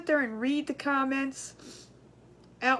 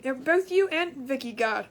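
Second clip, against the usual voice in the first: usual speaking voice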